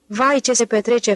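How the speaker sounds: ordinary delivery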